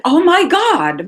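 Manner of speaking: a sudden realization, drawn out; retold astonishment. Client's retold shocked realization.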